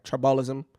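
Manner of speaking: "tribalism" tried softly as try-BALL-ism; unsure mispronunciation. Real, not acted. Vlogger checking his own word, unsure mispronunciation. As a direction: test out "tribalism" with an open "ball" middle. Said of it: "I don't even know if I said that right".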